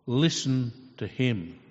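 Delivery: voice of God. Acted. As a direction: announce it slowly like a voice from heaven. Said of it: "God spoke and said".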